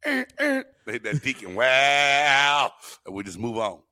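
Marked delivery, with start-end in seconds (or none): 0.0-0.6 high sung organ notes; organ sting imitation. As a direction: mimic a church organ hit. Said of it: "put an organ bell on there".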